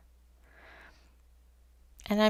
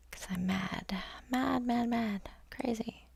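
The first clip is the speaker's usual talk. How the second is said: whispered mock madness, mostly breath; playful whisper; 2 semitones higher, 6 dB quieter than usual. Painter joking about working in one layer, playful whisper. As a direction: hush it like a silly secret.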